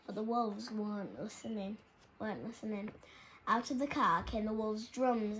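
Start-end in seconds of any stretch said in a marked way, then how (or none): none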